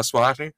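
Swathi with the last syllable blurred; mumbled ending. Shown as a demonstration. Host jokingly dodges the name, mumbling the end of Swathi. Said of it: "mumble the last part of it".

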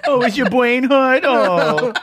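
mock baby talk.

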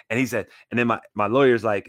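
ordinary talk

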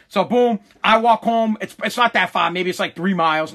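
normal talking voice

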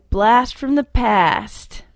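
slow, drawn-out mock Canadian accent. Shown as a demonstration. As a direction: stretch it out the way a Canadian would. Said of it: "Or as Canadians say".

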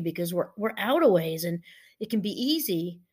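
usual speaking voice

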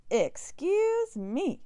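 high, loud, stretched-out outburst; storybook rabbit's shrieked protest; indignant shout. A narrator's reading. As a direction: shout it slowly in outrage. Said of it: "shouted Rabbit".

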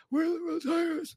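high, breathless gasp of a cheer; mock exhausted player trying to yell; breathless squeak. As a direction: wheeze it out with no air left. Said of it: "you're so out of breath".

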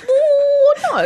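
high squeal of mock horror; horrified oh no. Staring at the mess on the bed, high horrified squeal. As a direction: act out the shock. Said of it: "you stand there looking at it like".

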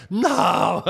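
loud, high, exasperated no; emphatic refusal. Retold or imitated. Manager told they want off the tour, emphatic refusal. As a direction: shut the idea down with a yelp.

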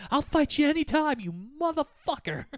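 high-pitched, hammed-up angry challenge; mock angry challenge. Acting out Rocky provoked into fighting.

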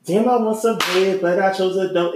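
rapping like [name]; loud, high [name] flow; channeling [name]. Acted. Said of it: "I channeled [name]".

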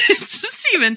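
really laughing through the words; giggle-choked attempt at talking; helpless laughter. Real, not acted. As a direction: try to speak while cracking up. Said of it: "Jess, you all right?".

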